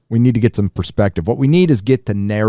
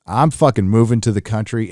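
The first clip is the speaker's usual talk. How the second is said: usual speaking voice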